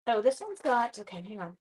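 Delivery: ordinary delivery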